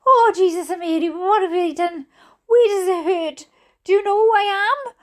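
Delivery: high, fast hysterical questioning; wailing mother. A narrator's reading. Mother fussing over injured sister, hysterical wail. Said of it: "wailed my mother".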